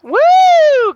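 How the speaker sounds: high, loud whoop of joy; excited cheer. Real, not acted. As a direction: cheer the play. Celebrating a good play.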